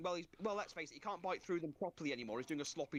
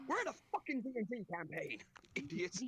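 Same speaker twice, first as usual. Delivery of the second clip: half-joking exasperated rant; snapping in character; 2 semitones higher than usual. Retold or imitated. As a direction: break character and snap at the table. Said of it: "before I slap out and go".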